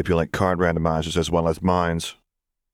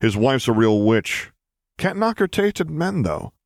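wicked satisfaction; 6 semitones higher than usual. A narrator's reading. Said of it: "with wicked satisfaction".

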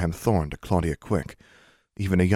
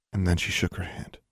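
plain reading voice

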